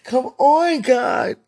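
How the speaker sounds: sobbing cry to God. Real, not acted. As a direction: cry out through tears. Crying out in prayer.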